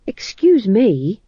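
slow, hesitant high excuse me; nervous polite interruption; bumbling fool. Acted.

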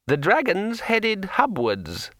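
high, slow, chatty sword voice; a cheerful magic sword rambling on; talking sword. A narrator's reading. Sword Kring speaks.

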